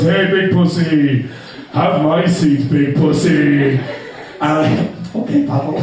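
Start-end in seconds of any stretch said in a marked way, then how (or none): 0.0-3.7 put-on slow, drawn-out Romanian-accented greeting; Pavel's slow greeting. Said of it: "he goes". 5.1-5.8 laughing reply. Retold or imitated. Meek reply through laughter.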